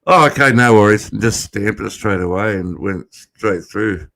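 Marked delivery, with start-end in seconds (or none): 0.0-1.1 agent says it louder and quick; a breezy, fast "no worries"; brisk, loud no worries. Retold or imitated. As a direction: wave him through briskly. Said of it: "And he goes".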